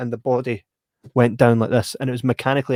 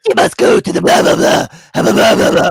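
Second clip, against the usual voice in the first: high loud cackling witch; witch's prophecy, high and loud; 9 semitones higher, 10 dB louder than usual. Retold or imitated. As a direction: screech it like a crone in a cave. Witch voiced in story.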